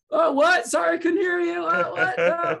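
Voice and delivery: high, loud fake deaf shout; mock shouting. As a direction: yell as if you can't hear over power tools. Dale faking not hearing dad.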